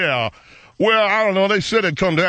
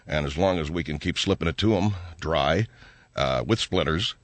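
much lower, softer sleazy tycoon; deep gruff businessman; 10 semitones lower, 5 dB quieter than usual. Acted. Oil analyst character voice.

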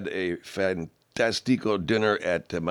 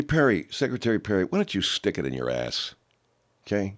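normal talking voice

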